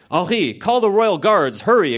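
high, urgent alarm; nervous exclamation. A narrator's reading. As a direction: call for help in a hammed-up panic. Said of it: "exclaimed Leslie nervously".

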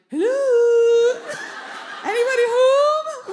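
singsong holler for whoever is there; calling out. Retold or imitated. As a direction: call nervously into an empty house, hammed up. Calling into possibly haunted house.

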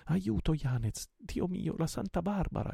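high, frightened cry for help; terrified woman's high-pitched call; exclaiming in alarm. A narrator's reading. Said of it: "esclamò Marianna". (grido d'aiuto acuto e spaventato)

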